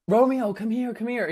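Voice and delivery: calling Romeo. Retold or imitated. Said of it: "She's like".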